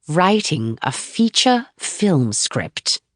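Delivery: slow, drawn-out announcement; stretching out the moment. Acted. Manager teasing the writer, drawing it out slowly. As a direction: savor each word to build suspense. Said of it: "stretching out the moment".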